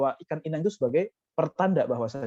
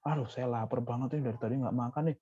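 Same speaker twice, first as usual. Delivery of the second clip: softer, whining "Aduh, saya lapar banget nih"; whiny complaint; 4 semitones lower, 6 dB quieter than usual. Shown as a demonstration. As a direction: mope about being hungry, quietly. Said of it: "Jangan seperti itu". (mengeluh lirih dan merengek)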